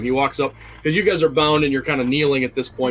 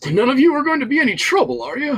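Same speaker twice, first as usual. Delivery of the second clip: high, loud, quick threat; menacing wizard; 14 semitones higher, 6 dB louder, faster than usual. Acted. Wizard warning kneeling captives, high menacing taunt. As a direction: loom over the captives with a sneer. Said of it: "he stands in the center and he says".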